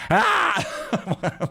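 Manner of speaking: sudden high "Ah!" cracking into a laugh; real giggly shriek; high laughing yelp. Real, not acted. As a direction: let a squeal of laughter burst out. Bursts out laughing after own question.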